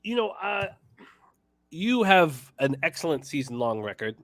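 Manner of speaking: ordinary talk, nothing marked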